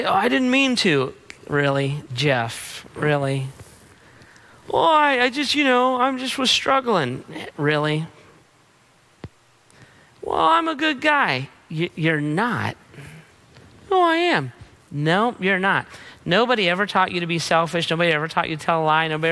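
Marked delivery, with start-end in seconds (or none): 0.1-1.1 high, whiny pleading voice; whiny excuse. Acted. Sinner making excuses to God. 4.7-7.2 high, whiny voice fumbling for words; whiny excuse. Acted. Sinner making excuses to God. 10.2-11.5 high, whiny pleading voice; whiny excuse. Acted. Sinner making excuses to God. 13.9-14.5 high, whiny insisting voice; cling to the claim like a caught child; whiny protest. Acted. Sinner insisting to God.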